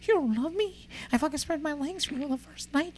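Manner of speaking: squeaky hurt girlfriend, hammed up; mocking a woman. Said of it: "She's like".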